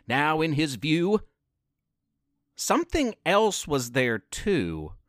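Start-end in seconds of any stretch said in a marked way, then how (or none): none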